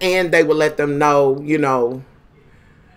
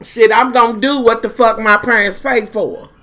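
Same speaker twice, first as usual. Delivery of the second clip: defiant; 3 semitones higher than usual. Retold or imitated. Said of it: "I was like".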